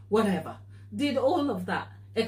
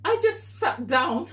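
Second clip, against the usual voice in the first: ordinary talk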